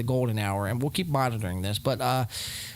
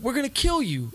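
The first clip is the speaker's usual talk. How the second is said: much higher, peak hammed-up threat; most oversold threat; 10 semitones higher than usual. Host escalates the mocked threat to the top, hammed-up. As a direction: go over the top with it. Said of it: "Try to sell it".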